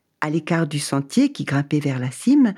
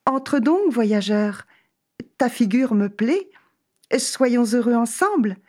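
high, sweet woman's voice; inviting woman; 7 semitones higher than usual. Acted. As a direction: coax him in warmly. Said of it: "Elle lui répondit".

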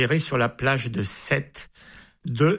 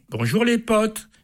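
cheeky greeting; 7 semitones higher than usual. Acted. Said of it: "Elle répond".

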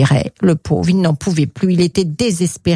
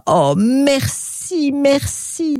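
high, drawn-out delighted thanks; rapturous gratitude; Pixie, overjoyed; 8 semitones higher, much slower than usual. Acted. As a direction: overflow with joy, stretching the words. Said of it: "débordant de joie".

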